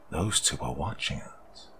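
put-on slow, flat little girl voice; eerie child speaking in monotone. A narrator's reading. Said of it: "That sweet little girl voice speaking in a monotone way".

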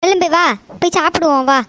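usual speaking voice

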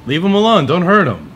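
loud, high, pleading cry; pleading shout. Pretend. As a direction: beg them to stop, loudly. Defending the bot from chat.

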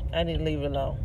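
ordinary talk

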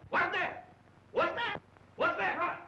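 jolted awake by a crash, yelping in fright; startled alarm. Acted. Jolted by the collapsing bed, he cries out in alarm.

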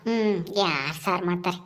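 put-on low, unhurried old village grandmother; old granny voice. Old Kadrajji asks the neighbour for curry, low granny voice. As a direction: speak as an old woman angling for leftovers.

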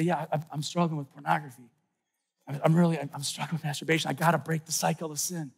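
low, soft, halting admission; subdued confession. Acted. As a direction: admit it quietly, as if in confession. Voices a penitent's confession.

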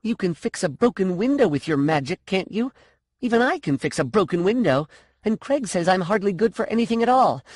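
high, heated argument; angry demand. A narrator's reading. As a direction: argue indignantly, words pouring out. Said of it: "she demanded angrily".

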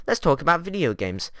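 ordinary talk, nothing marked

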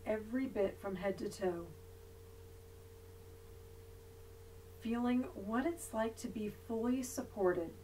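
soft, unhurried yoga nidra voice with long pauses; guided meditation. Real, not acted. Yoga teacher leading resting students through body awareness, guided meditation voice. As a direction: guide them gently into body awareness, leaving long silences.

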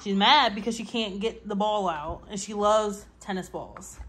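ordinary talk, nothing marked

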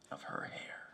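soft unvoiced whisper; hushed whisper on the final words. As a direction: breathe the words out tenderly. Poem line falls to whisper.

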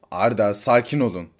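normal talking voice

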